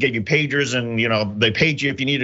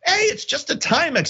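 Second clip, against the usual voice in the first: squeaky protest; 13 semitones higher than usual. Retold or imitated. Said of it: "I'm like".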